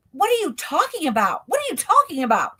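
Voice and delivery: high, urgent mock questioning; bewildered retold panic. Retold panicked exchange behind the door.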